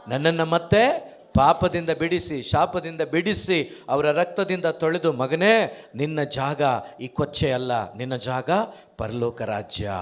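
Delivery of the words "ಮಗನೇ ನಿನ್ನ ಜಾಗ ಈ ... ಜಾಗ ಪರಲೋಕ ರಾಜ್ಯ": tender Jesus. Retold or imitated. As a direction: speak to him as a beloved son. Preacher voices Jesus speaking to sinner.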